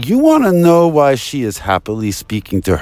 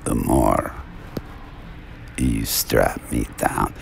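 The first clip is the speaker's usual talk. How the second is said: dropped low and soft, the quote's line repeated; quiet low retelling; 10 dB quieter than usual. Retold or imitated. Retelling the patient's words in a low hush. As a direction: let it sink to a low hush. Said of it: "She said to them".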